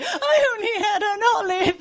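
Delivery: high, mock-prim protest; prissy diner. Diner protesting over the bill, lightly mocked. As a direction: whine it, hard done by. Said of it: "There's always one ... going".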